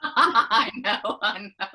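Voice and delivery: loud, laughing agreement; real laugh spilling into the words. Real, not acted. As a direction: say it through a burst of laughter. Laughing at co-host's address joke.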